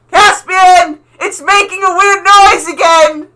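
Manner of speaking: high, loud yell from down the hall; yelling from another room. Acted. [name] yelling to Caspian from the other room, loud high shout. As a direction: bellow it through the house to get his attention. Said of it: "like, yelling from the other room".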